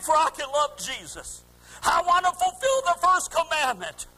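high, sustained pulpit cry; impassioned preaching. Acted. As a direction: preach it out at full force. Preaches, voicing the heart's cry.

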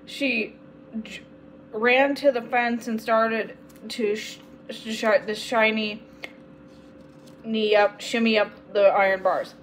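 plain reading voice

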